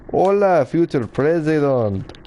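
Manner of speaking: put-on Spanish-accented greeting, future president slow. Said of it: "I don't know why I said that in that accent".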